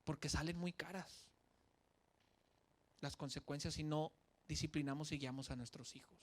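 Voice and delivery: ordinary talk, nothing marked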